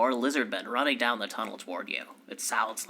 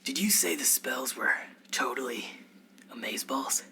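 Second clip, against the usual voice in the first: whisper. Acted. Character whispers in surprise.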